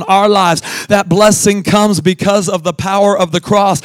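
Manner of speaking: fervent pulpit preaching, loud and building. Real, not acted. Pastor preaching to the church, fervent and loud. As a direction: preach it to the congregation with fire.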